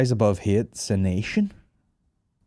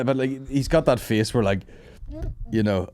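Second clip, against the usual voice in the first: ordinary talk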